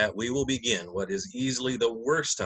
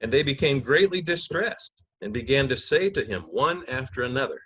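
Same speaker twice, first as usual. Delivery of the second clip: measured, solemn Gospel narration; liturgical reading; 3 semitones higher, 3 dB louder than usual. Acted. Narrator opening the Passion for the congregation, solemn reading. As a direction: read it like Scripture from the lectern.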